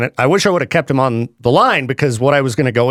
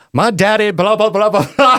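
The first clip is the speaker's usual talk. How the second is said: higher-pitched mock grown man whining about daddy; higher voice, running into babble; Danny McBride impression; 6 semitones higher than usual. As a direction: lightly imitate a Southern TV character, pitched up. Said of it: "it's Danny McBride. You know, he's".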